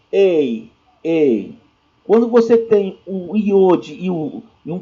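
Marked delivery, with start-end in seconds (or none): 0.1-1.5 "ei" as an E tinged with I; E gliding toward I. Shown as a demonstration. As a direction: let the E in "ei" slide into a half I. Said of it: "com um tom meio I". ("ei" com E puxando para o I)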